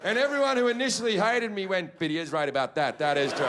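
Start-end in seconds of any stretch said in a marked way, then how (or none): 2.0-3.4 grudging agreement. Retold or imitated. Onlookers grudgingly agree with him.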